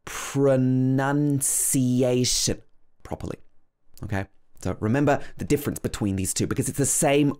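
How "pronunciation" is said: Pronunciation said slowly, every syllable clear; careful pronunciation. Shown as a demonstration. Teacher modelling the word for learners, slow and careful. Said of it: "the word pronunciation".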